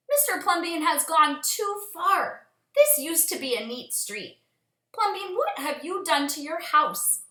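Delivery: indignant neighbors shouting at Plumbeam. Acted. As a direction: call out like outraged neighbors. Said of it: "the people shouted".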